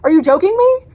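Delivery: loud, high, disbelieving yell; outraged shout. Real, not acted. Reviewer shouting about the book's ending, loud and high. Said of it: "ending of this book".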